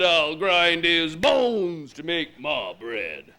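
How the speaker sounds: loud, slow giant chant; booming giant. Acted. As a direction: boom it out like a hungry giant. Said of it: "He said".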